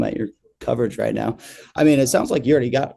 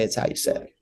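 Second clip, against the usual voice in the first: quiet; 5 dB quieter than usual. Real, not acted. Quiet emphatic punchline.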